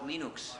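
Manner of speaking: Linux as LEE-nooks, with an oo; Turkish-style Linux. Shown as a demonstration. Speaker guessing the Turkish form, Linux as LEE-nooks. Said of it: "if you're speaking Turkish".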